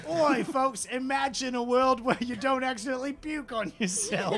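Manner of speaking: hammed-up British accent, calling to the room. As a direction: play a cartoon British musician. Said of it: "[name]'s, uh, hand goes".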